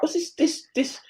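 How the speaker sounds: high, disbelieving cry of what's this; incredulous outburst. Retold or imitated. Vexed player confronting the coach, high disbelief. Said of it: "now I'm vexed".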